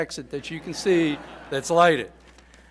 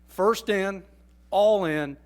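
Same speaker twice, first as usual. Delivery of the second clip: slogan lines, both punched louder; coined slogan; 4 semitones higher, 5 dB louder, much slower than usual. Shown as a demonstration. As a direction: announce the slogan with weight. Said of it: "I'd like to coin".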